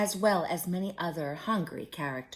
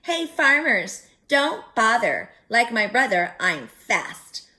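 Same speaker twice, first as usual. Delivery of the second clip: calling out; 2 semitones higher, 8 dB louder than usual. A narrator's reading. Gingerbread girl calls to the farmers, loud and teasing. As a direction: call back over her shoulder, teasing. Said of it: "She laughed and called".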